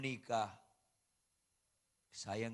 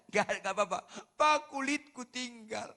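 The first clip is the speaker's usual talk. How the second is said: voiced child; 11 semitones higher, 9 dB louder than usual. Child's words voiced by father.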